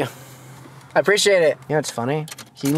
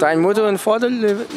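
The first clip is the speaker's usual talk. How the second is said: hammed-up German, higher and louder; mock German accent; 8 semitones higher, 4 dB louder than usual. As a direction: play a tourist speaking German. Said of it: "playful German questions".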